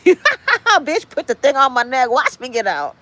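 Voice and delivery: high, sassy cat voice; cat talking back. Retold or imitated. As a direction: play the cat mocking its owner. Voicing the cat defying her.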